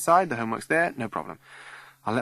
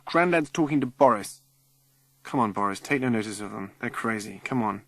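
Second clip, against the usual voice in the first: ordinary delivery, nothing marked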